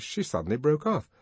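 ordinary delivery

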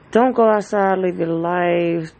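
flip side shout. Retold or imitated. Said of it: "Flip side".

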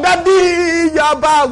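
loud, high, drawn-out squeal of welcome; excited child greeting. Acted. Child greeting Daddy back home, hammed-up excited welcome. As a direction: shout it like an overjoyed child.

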